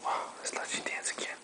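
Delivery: fast whisper. Real, not acted. Father whispers to baby, fast whisper. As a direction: whisper it quickly.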